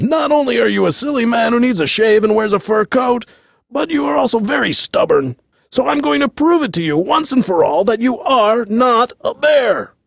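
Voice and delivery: high, indignant tirade; exasperated president. A narrator's reading. President loses patience with the bear.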